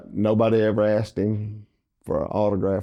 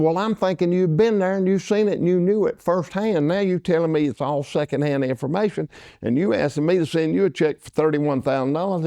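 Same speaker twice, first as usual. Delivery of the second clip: mock aggrieved rant, higher; aggrieved complaint; 7 semitones higher than usual. Dale complaining about the check, high aggrieved mock rant. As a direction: lay out his grievance step by step.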